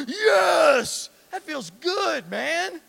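loud happy "yes" then satisfied sigh of relief; relieved cheer. Acted. Husband forgiven by his wife, a loud relieved yes.

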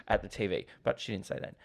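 usual speaking voice

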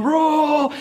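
high, held one-syllable "Rural", like roll; wife trying rural. Retold or imitated. Wife trying to say it right, strained "Rural". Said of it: "my wife's trying to say rural right".

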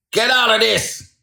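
high, loud order to leave; angry shout. A narrator's reading. Salesman driving the little man away, angry shout. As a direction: shout him off the premises.

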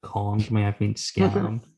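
usual speaking voice

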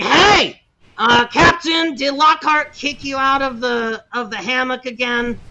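loud, high teasing shout, slowing; loud teasing call. Acted. A brother teases the captain about the hammock, loud and high. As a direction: call across the deck with a grin.

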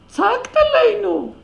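high, loud accusing cry; imitating his indignant shout, slow and high; retold yell. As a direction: hurl the accusation back at her. Quoting his accusing outburst.

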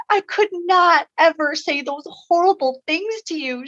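high voice of someone overcome, lightly acted; retold tearful sobbing. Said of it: "started streaming tears and sobbing".

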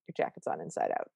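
hushed, breathy aside; whisper. Retold or imitated. Jackson warning Huck her jacket is inside out, whispered. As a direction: whisper the warning so nobody hears.

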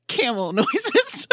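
laughing. Real, not acted. Reading Anna's bracket note laughing.

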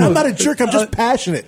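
high-pitched, amused self-defense; mock protest. Answering fans who called him a jerk, mock protest.

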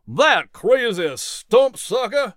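high, drawn-out cry; mock shout. A narrator's reading. Jack running after Bill, high mild shout. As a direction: call out crossly without full volume.

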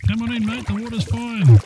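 echoey voice from a diving bell; inside a diving bell. Pretend. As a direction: sound like you're down in a metal bell.

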